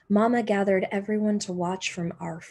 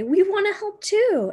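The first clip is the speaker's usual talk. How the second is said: high, louder eager voice; eager offer; 12 semitones higher, 7 dB louder than usual. Acted. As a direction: chime in eagerly. Neighbours offer help.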